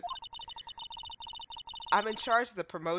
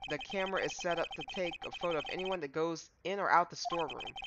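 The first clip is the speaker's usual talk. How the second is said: normal talking voice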